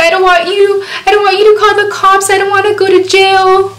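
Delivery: high-pitched tearful begging; retold crying. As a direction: imitate a desperate crying plea. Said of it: "I was just crying".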